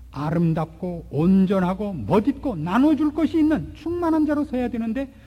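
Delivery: ordinary talk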